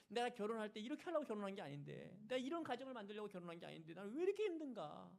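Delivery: high, strained cry of despair; acted tearful lament. Wife weeps over her marriage, high acted lament. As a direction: plead as the weeping wife, pitched high. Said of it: "눈물이 막 쏟아지는 거예요".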